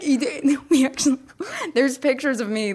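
usual speaking voice

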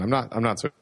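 usual speaking voice

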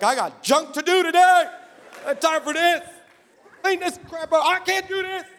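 high, strained mock rant; hammed-up fed-up outburst; exasperated complaint. Acted exasperation at the demon.

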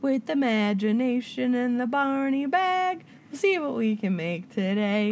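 put-on slow, sing-song children's TV host; Barney voice. Quoting Barney to Wesley in a slow children's show voice. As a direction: chant it like a kids' show host. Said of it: "how I learned how to do arts and crafts".

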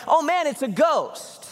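high-pitched, drawn-out mock alarm; mock freak-out. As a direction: act out the disciples freaking out. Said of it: "They freak out".

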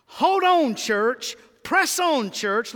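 high, loud rallying cry; preaching shout. Real, not acted. Preacher to church, a loud high rallying shout. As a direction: lift the church up, calling out loud.

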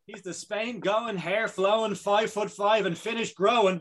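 rhyming hype-man intro; announcer intro. Acted. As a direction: introduce him like a ring announcer. Hosts rhyming intro again.

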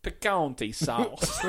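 very slow, hammed-up Texas drawl; Texas accent. Correcting the salsa name, slow Texas drawl. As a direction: stretch it out like a cartoon Texan. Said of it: "Can you do a Texas accent?".

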